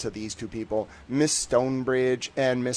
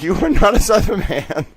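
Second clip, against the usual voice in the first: high, loud imitation of outraged Southerners; mock accusation; 8 semitones higher, 9 dB louder than usual. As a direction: shout it down in playful disbelief. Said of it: "They'd be like".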